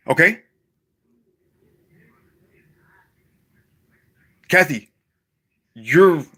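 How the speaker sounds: loud, sharp call aimed off-mic; yelling at Kathy. Real, not acted. Vlogger yelling over at Kathy during class recording, loud and sharp. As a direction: snap at her loudly mid-recording.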